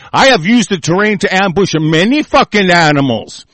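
normal talking voice

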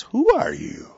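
high pitched exasperated cry; mock exasperated juror. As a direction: squeal the question at the holdouts. Acted juror confronting plants.

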